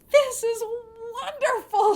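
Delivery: high, loud delighted cry; girl thrilled by the magic food. Acted. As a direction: burst out with joy. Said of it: "she said with a smile".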